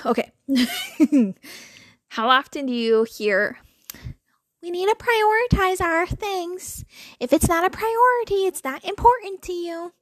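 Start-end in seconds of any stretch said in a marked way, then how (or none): 4.6-9.9 mock the smug advice-giver, whining it out; most annoying voice. Mocking the smug prioritizing advice in an annoying voice. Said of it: "the most annoying voice".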